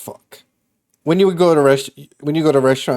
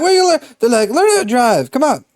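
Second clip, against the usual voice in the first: high-pitched, exasperated mock yelling; imitating an impatient motorist; mock irate driver; 11 semitones higher, 3 dB louder, faster than usual. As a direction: heckle a learner driver in a raised pitch.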